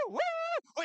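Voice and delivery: high, excited whooping cheer. Real, not acted. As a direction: whoop for the new leader. Commentator cheers new leader.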